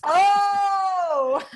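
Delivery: high, drawn-out oh; amused realization. Pretend. Host reacting to co-host's date joke, playful surprise. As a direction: let a long high oh tip into laughter.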